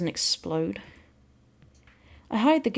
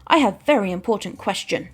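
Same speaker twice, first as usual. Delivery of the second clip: grave alien announcement; 2 semitones higher, 5 dB louder than usual. Acted. The recruit warns the narrator of a vital question, mock-grave. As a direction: make it sound like life or death.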